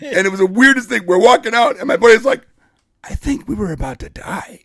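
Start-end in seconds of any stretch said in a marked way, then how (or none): 3.0-4.6 hushed, stunned confession; imitating shaken friend. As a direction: say it softly, as if still shaken. Shaken buddy's remark after the escape.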